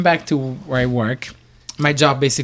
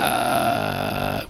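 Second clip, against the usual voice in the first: very low, drawn-out croon; sultry low hesitation sound; sexy [name] voice; 6 dB quieter than usual. Real, not acted. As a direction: drop the voice far down on a long hesitation. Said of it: "That was sexy [name] voice again".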